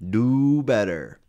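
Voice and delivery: loud, high, stretched-out scolding; forceful admonishment. Real, not acted.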